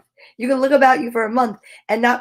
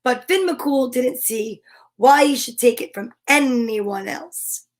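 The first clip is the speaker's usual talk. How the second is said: usual speaking voice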